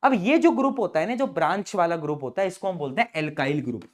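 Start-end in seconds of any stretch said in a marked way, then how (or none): none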